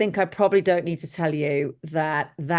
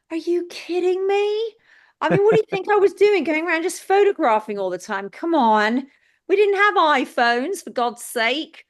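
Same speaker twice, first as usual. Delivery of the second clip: high, loud, incredulous rant; Are you kidding me, pitched way up in disbelief; exasperated; 11 semitones higher, 4 dB louder than usual. Real, not acted. Exasperated at the photo question.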